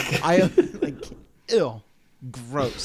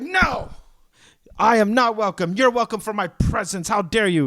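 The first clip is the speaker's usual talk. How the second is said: higher, louder hammed-up offence; mock indignation; 6 semitones higher, 4 dB louder than usual. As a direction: play the outraged diva, tongue in cheek. Mock offended customer rant.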